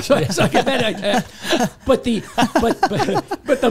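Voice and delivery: talking through a real laugh. Real, not acted. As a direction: laugh as you push on.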